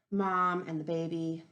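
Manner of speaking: plain reading voice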